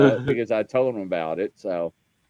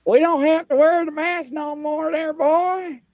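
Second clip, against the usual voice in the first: much higher mock yell of a receptionist; imitating a yelling lady. Retelling the waiting room lady yelling at him, high mock yell. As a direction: squeal it out like a shouty front-desk lady. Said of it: "she's screaming at me".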